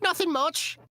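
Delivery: very high, loud mock British urchin; begging child. Little children asking for money, high mock British pleading. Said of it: "little children asking for money".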